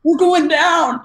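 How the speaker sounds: loud, high hammed-up wail; mock doom. Nervous flyer imagining a crash, loud mock wail. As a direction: cry out the plane is crashing. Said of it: "that would be telling me".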